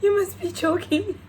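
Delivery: high, half-crying incredulous voice; high-pitched retold disbelief; mock disbelief. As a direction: wail it in comic denial. Acting out disbelief at owner.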